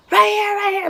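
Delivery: high-pitched mock yell, urgent and abrupt; imitated sudden yell. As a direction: mimic a passenger yelling out directions. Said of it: "he just yells".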